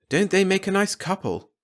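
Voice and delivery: a mother sighing happily over the couple; Marge's voice. Acted. A wife to her husband after the guests leave, fondly.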